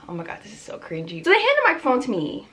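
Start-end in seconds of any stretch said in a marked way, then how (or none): 0.1-1.2 fast, much softer embarrassed aside; cringing mutter. Real, not acted. Vlogger cringing at her own story, fast and hushed. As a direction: cringe at the memory under your breath.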